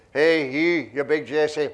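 loud, slow telling-off from the back; heckler's shout. Retold or imitated. Man at the back telling the manager off, loud slow shout. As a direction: bawl it across the hall, slowly.

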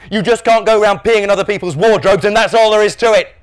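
high, loud, emphatic telling-off; dad laying down the law. A narrator's reading. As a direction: end the argument with force.

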